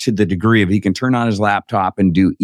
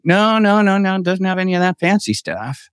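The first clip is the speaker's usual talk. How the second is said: high-pitched string of denials; retold dismissal; 10 semitones higher than usual. Brother waving away questions about fancy features, dismissive. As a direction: brush off the questions in a higher voice.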